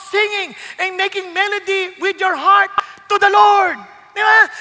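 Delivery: loud, driving sermon delivery; full-voiced pulpit exhortation; fiery preaching. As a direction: preach it out to the whole room. Preaches Spirit-filled worship loudly.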